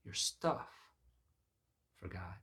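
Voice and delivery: usual speaking voice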